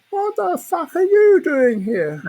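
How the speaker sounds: loud, high, slow mock shout across a huge hall. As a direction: bellow it from a hundred yards away. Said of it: "He shouts top of his voice".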